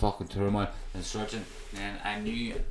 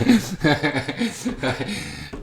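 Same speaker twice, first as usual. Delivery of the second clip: a burst of real laughter; laughing; 5 semitones higher, 4 dB louder than usual. Real, not acted. Laughing at his own wake story. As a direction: laugh out loud at the joke.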